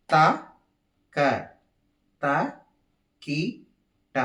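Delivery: five detached beats, evenly spaced; counted rhythmic syllables. Shown as a demonstration. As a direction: demonstrate the count with a pause after each syllable. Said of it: "సహజంగా పలుకుతూ ఉంటాం".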